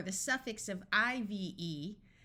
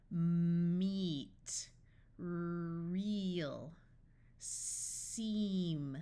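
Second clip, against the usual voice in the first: each sound held long and run together; drawn-out phonics blending; 2 semitones lower, much slower than usual. Shown as a demonstration. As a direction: stretch the word slowly from first sound to last.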